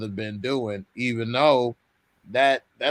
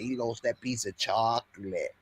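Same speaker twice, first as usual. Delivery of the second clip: imitating New York accent; 2 semitones lower, 3 dB quieter than usual. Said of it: "Trying to do my moochie right here".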